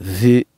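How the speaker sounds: "ذي" with the tongue tip out, a voiced th; dhal demo. Shown as a demonstration. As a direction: say "ذي" like "the" with a long i. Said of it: "on dit ذي".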